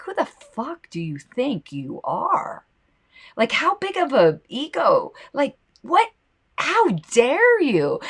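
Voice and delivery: slow, high indignant disbelief; indignant rant. Retold or imitated. Scolding lawmakers who pass bills, slow high indignation. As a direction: tell off the little tyrants, drawn out. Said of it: "I'm getting all worked up".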